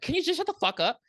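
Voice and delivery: mock high snap; irritated jab at her sister; snapping at sister. Woman snapping at her sister, high mock. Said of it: "she was like".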